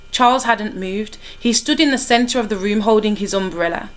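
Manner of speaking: usual speaking voice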